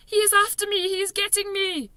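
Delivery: high, loud frightened wail; panicked cry. A narrator's reading. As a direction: cry out in terror. Giant screaming in nightmare.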